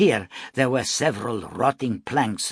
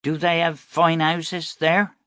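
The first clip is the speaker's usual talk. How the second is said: put-on child voice; 8 semitones higher, 4 dB louder than usual. Boy asks about heaven.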